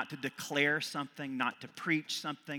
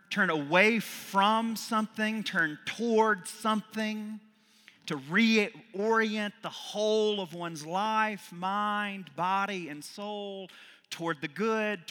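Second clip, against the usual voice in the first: high, loud rising sermon cadence; preaching; 8 semitones higher, 6 dB louder than usual. Acted. Priest preaching repentance to the congregation with rising fervor. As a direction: lift each phrase like a call to the faithful.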